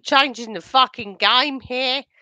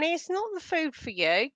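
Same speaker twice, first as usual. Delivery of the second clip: ordinary talk, nothing marked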